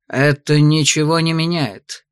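low, slow, cutting icy tone. Acted. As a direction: cut him off coldly. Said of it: "ледяным голосом отрезал герцог".

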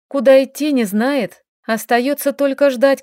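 usual speaking voice

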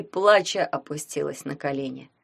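usual speaking voice

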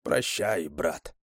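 low, falling, subdued goodbye; sad farewell. A narrator's reading. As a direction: say a quiet mournful farewell. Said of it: "с грустью подумал я". (с грустью, тихо)

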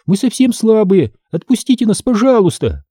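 high-voiced, urgent plea; voiced girl crying out; Эмили pleading. A narrator's reading. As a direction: beg in a high voice, not fully shouting. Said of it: "закричала в ответ Эмили".

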